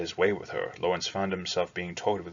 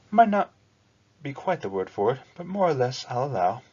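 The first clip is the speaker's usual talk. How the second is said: high, bright-voiced girl conceding grudgingly; a young girl allowing it with a sly reluctance; higher, louder girl's voice, half-teasing; reluctant girl; 6 semitones higher, 5 dB louder than usual. Hullo answers reluctantly.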